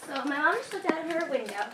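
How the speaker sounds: ordinary talk, nothing marked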